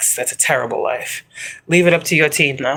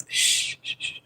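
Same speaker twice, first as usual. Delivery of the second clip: soft unvoiced shh, shh; shushing whisper; 9 dB quieter, slower than usual. Real, not acted. Hushing her audience with a whispered shh. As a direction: hush the room with a whispered shh. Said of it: "Like I said, quiet".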